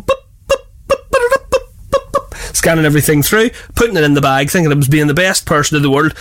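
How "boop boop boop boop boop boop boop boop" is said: high beeping scanner sound; scanner beeps. Retold or imitated. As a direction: mimic a till scanner. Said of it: "I'd have been going".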